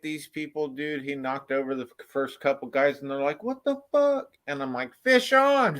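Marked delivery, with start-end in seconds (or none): 3.4-4.4 startled yell. Retold or imitated. People knocked over by fisherman. 5.0-5.8 high excited call; shouting. Retold or imitated. Calling out a hooked fish.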